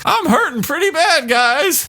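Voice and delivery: very high, strained whimper; high pitiful mock whine; pained whiner. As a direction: squeak it out in pain. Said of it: "he's just like".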